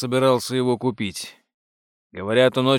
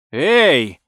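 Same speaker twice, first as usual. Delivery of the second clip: sharp, loud angry call, pitched up; furious cry; 3 semitones higher, 7 dB louder than usual. A narrator's reading. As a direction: cry out in anger to stop someone. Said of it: "яростно вскричал он".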